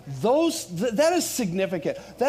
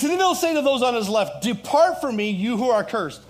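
loud, raised declamation of judgment; forceful scripture preaching; 7 semitones higher, 7 dB louder than usual. Real, not acted. Pastor reading judgment to those on the left, forceful preaching. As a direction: proclaim the verse with full force.

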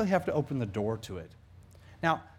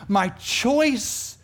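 "choice" stretched out, high and emphatic; stress on choice; 7 semitones higher than usual. Real, not acted. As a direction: land hard and slow on "choice". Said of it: "the word choice, guys, it's critical".

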